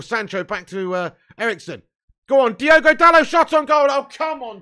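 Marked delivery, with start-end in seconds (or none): 2.3-4.6 high, very loud yell easing into frustration; urgent shouting. Real, not acted. Fan urges Diogo to shoot on goal, urgent shouting. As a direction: shout the player on, then protest.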